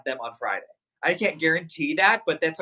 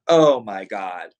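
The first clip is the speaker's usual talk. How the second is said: awed low gasp; 9 semitones lower, 7 dB louder, much slower than usual. Real, not acted. Amazed by the Gucci pillows.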